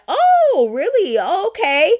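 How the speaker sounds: high, swooping sarcastic reaction; mock surprise. Sister hearing from her brother what was said, mock surprise. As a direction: act out hearing the gossip with exaggerated disbelief.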